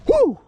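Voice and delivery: loud high whoop of joy; high triumphant woo; excited whoop. Real, not acted. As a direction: let out a big celebratory whoop. Celebrating landing a fish.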